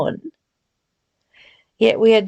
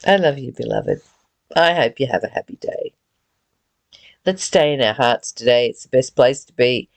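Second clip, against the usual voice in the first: tender blessing; 8 semitones lower, faster than usual. Real, not acted. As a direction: bless the listener gently in a lower voice. Loving blessing closing the talk.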